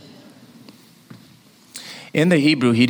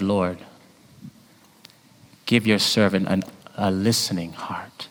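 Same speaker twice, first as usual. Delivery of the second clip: softer, whispered prayer; hushed prayer; 3 semitones lower, 4 dB quieter than usual. Acted. Preacher repeating Solomon's prayer to God, whispered.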